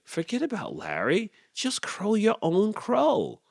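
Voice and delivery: imploring. A narrator's reading. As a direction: beg him gently up high. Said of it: "she implored".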